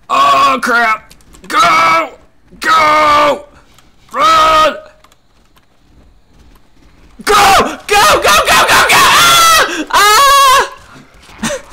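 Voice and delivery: high frantic screaming; panicked shouting. Real, not acted. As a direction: shout in real panic, fleeing. Fleeing monster in panic.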